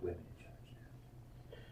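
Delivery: slow, hushed whisper; very soft imitated whisper; whispered quote. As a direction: whisper it slowly as an aside.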